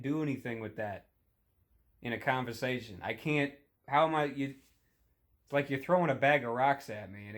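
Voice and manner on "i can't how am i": ordinary delivery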